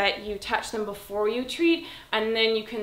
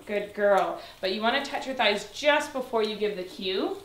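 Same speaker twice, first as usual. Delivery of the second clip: usual speaking voice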